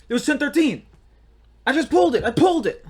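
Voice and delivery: high, loud triumphant exclamation; triumphant boast. Real, not acted. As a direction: crow about it, high and loud. Triumphantly retelling his lucky guess.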